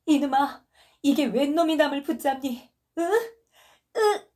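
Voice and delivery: high, loud raging yell. Acted. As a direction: shove him off with fury. Wife fights husband, raging. (높고 크게 분노한 고함)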